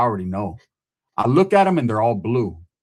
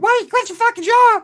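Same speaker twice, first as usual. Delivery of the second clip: mocking people; 7 dB louder than usual. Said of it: "People are like".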